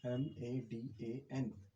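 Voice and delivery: slow letter-by-letter spelling. Shown as a demonstration. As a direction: spell it out slowly, one letter at a time. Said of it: "अब मदन लिखते हैं हम". (धीरे-धीरे spelling बोलना)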